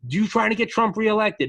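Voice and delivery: high, loud mock yell at protesters; imitating an angry shop owner yelling; retold yell. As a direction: shout it up at the rioters. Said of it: "he's yelling at the protesters".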